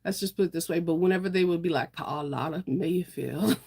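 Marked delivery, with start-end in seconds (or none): 1.9-3.6 southern drawl. Retold or imitated. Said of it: "I wanna say it with a southern drawl".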